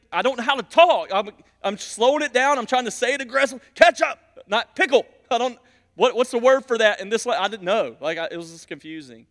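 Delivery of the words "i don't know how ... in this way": louder, higher flustered protest; exasperated inner voice. Retold or imitated. Pastor protesting to his wife, flustered and louder. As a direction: protest that you just can't find the words. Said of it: "in my heart, I'm like".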